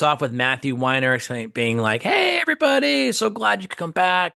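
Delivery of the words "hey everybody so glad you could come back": mock effusive welcome. Showrunner opening the table read, imitated effusive welcome. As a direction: imitate a boss gushing hello to the room.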